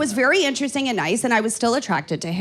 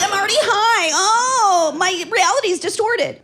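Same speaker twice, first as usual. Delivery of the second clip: stoned panic; 8 semitones higher than usual. Retold or imitated. Cookie eater realizes the pot cookies, in mock panic. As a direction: freak out as if already high. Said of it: "It's like".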